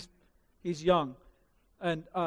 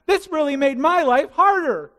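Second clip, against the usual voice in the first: higher, louder put-upon complaint; self-pitying grown-up; 7 semitones higher, 7 dB louder than usual. Retold or imitated. Grown-up confronted about sin, loud self-pitying complaint. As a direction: moan like everything is unfair to you. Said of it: "this is how grown-ups react".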